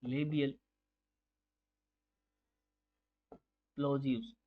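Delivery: Bilabial, long pause, then plosives, each word set apart; slow, spaced dictation. Shown as a demonstration. Teacher repeating the term slowly for students to note. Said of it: "क्या कहते हैं?".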